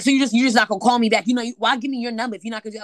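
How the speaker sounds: fast, loud, indignant ranting; mock angry caller. Guy on the phone berating her for not replying, fast and loud. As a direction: hammer her with fast complaints.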